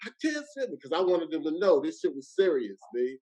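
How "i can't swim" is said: high but soft mock scream; small, high-pitched pretend cry; retold screaming. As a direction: imitate screaming at reduced volume. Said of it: "So I just started screaming".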